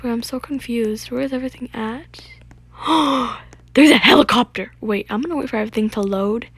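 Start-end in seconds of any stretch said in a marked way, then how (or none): 2.8-3.4 long audible gasp; astonished gasp. Real, not acted. Gamer spotting a helicopter in game, astonished gasp. 3.7-4.7 loud surprised outburst; amazed exclamation. Real, not acted. Gamer spotting something new on the map, amazed shout. Spots a helicopter in game.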